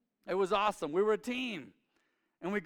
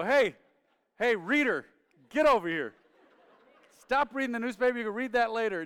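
loud calling-out dad, higher and louder; imitating dad's yell; 6 semitones higher, 7 dB louder than usual. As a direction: bark at the lazy son like dad did. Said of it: "And Dad would go".

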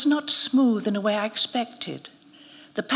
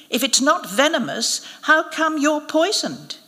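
high, pointed challenge; smug jibe; mocking taunt; 7 semitones higher, 4 dB louder than usual. A narrator's reading. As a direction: goad him, pitch climbing. Smirking taunt of injured priest.

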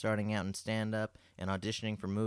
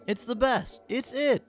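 upbeat, higher ad announcer voice; ad read; 9 semitones higher, 3 dB louder than usual. Acted. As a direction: sell it like a radio spot. Sponsor ad read.

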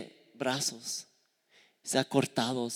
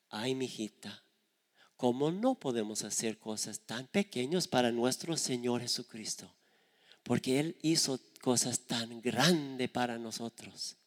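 softer, lower, tender mother; gentle motherly reply; 3 semitones lower, 7 dB quieter than usual. Retold or imitated. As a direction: soothe the child gently. La madre responde a la hija. (madre tierna, voz suave y baja)

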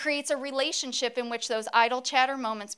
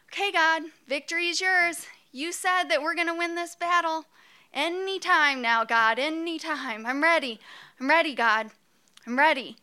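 tiring Moses pleading, higher and louder; Moses impersonation; 5 semitones higher, 4 dB louder than usual. Pretend. Moses at the hilltop, higher, urgent plea. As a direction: Moses straining, begging God to hurry. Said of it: "so here I am as Moses".

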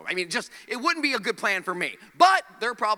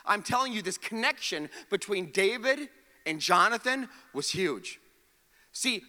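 ordinary delivery

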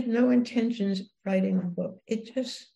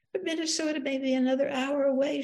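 higher-pitched plaintive complaint; moaning; 6 semitones higher than usual. A narrator's reading. Wife protesting the long wait to senator, plaintive moan. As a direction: moan it like a weary plea. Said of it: "she moaned".

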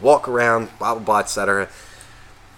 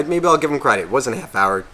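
ordinary talk, nothing marked

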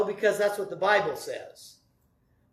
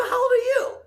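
high squeaky mock question; 4 dB louder than usual. Retold playful question.

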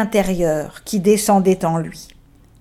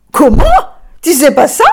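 loud, higher disbelieving question; astonished; 5 semitones higher, 6 dB louder, faster than usual. Acted. Les enfants s'étonnent que l'âne ignore où il est, stupéfaits. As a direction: act stunned he doesn't know.

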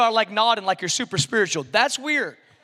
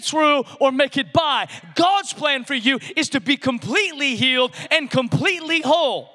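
full-force preaching; 6 semitones higher than usual. Acted. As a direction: preach it out, lifting every question.

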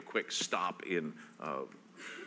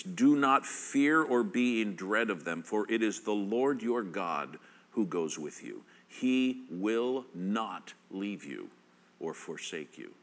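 measured scripture read building to a firm call; steady reading swelling toward preaching. Acted. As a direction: read it like a promise, then press the question. Reads scripture with rising force.

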